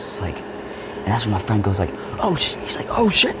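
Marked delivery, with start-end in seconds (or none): 2.2-2.6 high, startled oh shit; startled exclamation. Retold or imitated. Friend seeing me chase him, high startled cry. 2.9-3.3 much higher and louder oh shit; shocked exclamation. Retold or imitated. Friend watching the chase, high shocked yelp.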